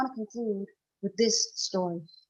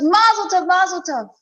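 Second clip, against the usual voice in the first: high, loud, jubilant cry of congratulation; joyous blessing; 6 semitones higher, 8 dB louder than usual. Acted. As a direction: burst out with overflowing joy. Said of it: "And he says the words".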